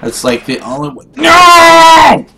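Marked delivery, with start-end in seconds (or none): 1.1-2.3 real yell. Real, not acted. Player raging at game character.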